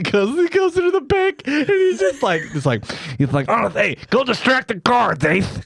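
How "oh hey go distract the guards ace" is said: high, hammy comic uncle; pitched-up mock heist leader; uncle giving orders. Acts uncle giving orders in bank.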